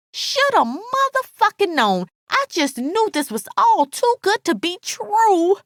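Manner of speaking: high, anguished outburst; hurt and angry cry. A narrator's reading. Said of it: "she cried".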